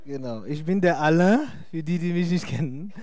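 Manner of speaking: usual speaking voice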